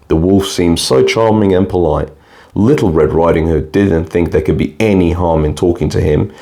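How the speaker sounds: usual speaking voice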